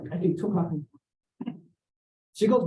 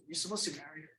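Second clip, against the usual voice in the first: a voice heard in the head, much softer; eerie soft inner voice; hushed inner voice; 3 semitones lower, 16 dB quieter, faster than usual. Retold or imitated. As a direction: hush it like a voice from nowhere. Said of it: "This voice says".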